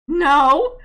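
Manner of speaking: loud, emphatic mock denial; exasperated no. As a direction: belt out a fed-up denial. Acted answer to parents' question.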